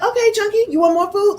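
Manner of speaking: high teasing voice; universe mocking. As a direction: tease like the universe itself. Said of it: "the universe is like".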